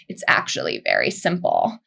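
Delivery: very low, creaky vocal fry. Shown as a demonstration. Coach answers how to solve it, in creaky vocal fry. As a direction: drop right down into the creak.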